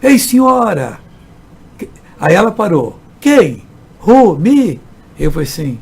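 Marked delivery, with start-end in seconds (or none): 0.0-1.0 loud, high call across the street. Retold or imitated. Pastor calling to a passing lady, loud and high. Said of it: "eu gritei em português". 3.2-4.8 loud, high startled reply; startled, pointing at herself; surprised. Retold or imitated. Woman startled at being hailed in the street, loud. Startled lady answering the call.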